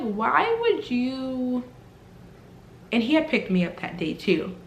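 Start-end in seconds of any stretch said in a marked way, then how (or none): 0.0-1.6 acted objection. Retelling her objection to boyfriend's plan.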